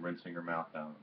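ordinary delivery, nothing marked

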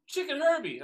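high, loud garbled name; mother mangling the name. Retold or imitated. Mother telling her son the name, high and loud. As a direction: blurt out the famous name wrong. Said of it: "And I said".

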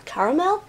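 "Caramel" with first syllable like "car", rising; trying out pronunciations. Shown as a demonstration. Asking viewers how they say "Caramel", CAR-uh-mel. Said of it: "How do you guys say it".

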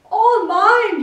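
triumphant high shout; cry out. A narrator's reading. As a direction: crow it out loud. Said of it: "Yertle cried".

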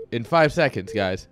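ordinary talk, nothing marked

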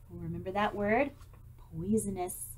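ordinary delivery, nothing marked

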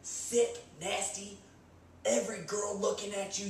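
ordinary talk

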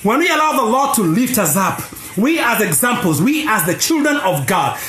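ordinary delivery

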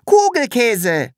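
high, slow delighted shout; calling out. A narrator's reading. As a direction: call it out with glee. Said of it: "rief das Sams".

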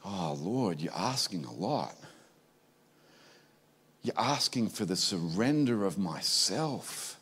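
low, very soft plea to God; soft, low surrendering prayer; hushed prayer. Acted. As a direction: yield to God quietly, voice dropped right down. Acted prayer answering God's costly demand.

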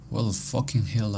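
usual speaking voice

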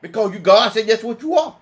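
normal talking voice

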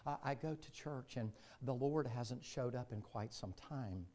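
a hint of a prim, proper churchgoer, said quietly; softly mock the polished complaint. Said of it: "y'all don't say it that way".

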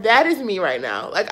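ordinary talk, nothing marked

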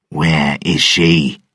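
a touch of threat; mild anger. Acted. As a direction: press him, coldly. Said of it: "Mercer said angrily".

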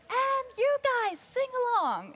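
high, slow crowd call; rock star call. Retold or imitated. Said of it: "rock star trick".

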